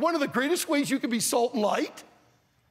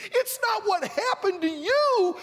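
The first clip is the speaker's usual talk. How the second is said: ordinary talk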